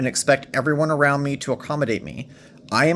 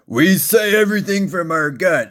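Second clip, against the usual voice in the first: loud, higher voice pushed from the gut; Marine Corps gut voice; 5 semitones higher, 5 dB louder than usual. Retold or imitated. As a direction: bark it out like a Marine from the belly. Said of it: "the Marine Corps thing".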